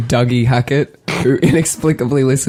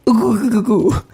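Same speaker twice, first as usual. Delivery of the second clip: high goofy hooting; Adam Sandler; 9 semitones higher than usual. Acted. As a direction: hoot a run of high oohs. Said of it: "in the words of Adam Sandler".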